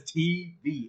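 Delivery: T V slowly, each letter separate; T, pause, V; two individual letters. Retold or imitated. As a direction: imitate a friend drawing out T V. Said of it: "he says it two individual letters".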